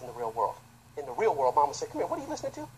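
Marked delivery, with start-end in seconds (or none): 1.9-2.7 higher mock scolding mother. Mama scolding her son over his music, higher-pitched mock scolding. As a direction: chew out the kid like mama.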